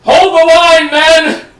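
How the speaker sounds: battle shout. Acted. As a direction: shout orders over the fighting. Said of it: "I know I did the voice badly".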